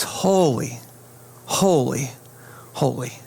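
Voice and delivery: low, hushed awe with long falling words; reverent refrain. Acted. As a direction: intone each word slowly, letting it settle. What echoes in heaven tonight.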